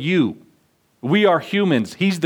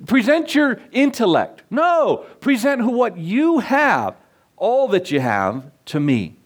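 higher, insistent preaching; 5 semitones higher than usual. Acted. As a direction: drive the question home to the congregation. Preaching at full force.

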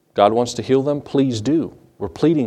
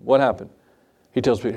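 normal talking voice

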